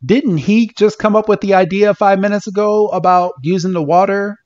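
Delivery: real incredulous rant, pitch shooting up; indignant disbelief. Real, not acted.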